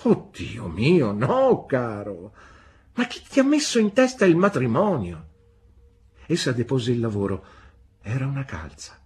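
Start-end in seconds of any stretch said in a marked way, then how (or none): 0.0-5.3 loud, high-swooping protest; flustered exclamation. A narrator's reading. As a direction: exclaim in astonished disbelief. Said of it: "esclamò Pigoti".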